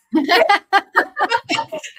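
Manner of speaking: real laugh bursting out; amused laugh at her own joke; bright laugh. Real, not acted. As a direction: laugh at yourself, high and loud.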